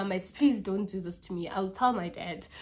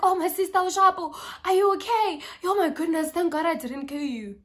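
worried stranger; 6 semitones higher than usual. Retold or imitated. Man checks on her after crash.

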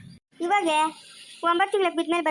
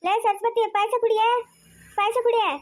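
calling out; 5 semitones higher than usual. Acted. Calling wife across the house.

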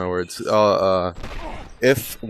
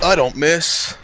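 mock cool boast; 8 semitones higher, 3 dB louder, slower than usual. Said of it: "I wanna be cool and shit".

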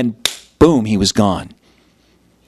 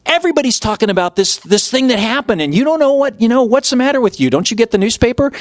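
much higher, fast, disbelieving; incredulous; 10 semitones higher, faster than usual. Retold or imitated. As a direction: scoff as if he's lost his mind. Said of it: "they're looking at him like".

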